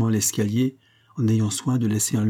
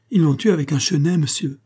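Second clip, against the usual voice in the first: higher, agitated report through tears; a distraught caretaker breaking the news; tearful outburst; 6 semitones higher, 4 dB louder than usual. A narrator's reading. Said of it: "qui buvait ses larmes". (émoi larmoyant)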